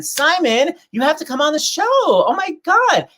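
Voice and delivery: high-pitched gushing invitation. Real, not acted. Host begging a friend to come on the show, gushing. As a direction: plead excitedly, stretching the last words high.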